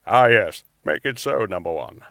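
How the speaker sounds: a light, measured starship captain voice; lightly voiced commanding captain; Patrick Stewart impression. Shown as a demonstration. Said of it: "no, Patrick Stewart".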